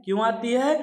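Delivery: normal talking voice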